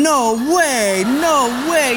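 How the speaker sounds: drawn-out, loud incredulous protest; loud disbelief. Acted. Holy guy hears the wrong answer accepted, loud disbelief. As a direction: wail it in disbelief.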